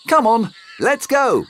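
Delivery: a high, eager storybook uncle; high Uncle Jack voice. Acted. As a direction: pitch up into the friendly uncle.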